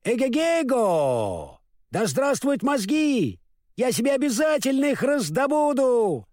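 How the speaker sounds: scarecrow's happy whoop; joyful shout. A narrator's reading. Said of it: "весело закричал".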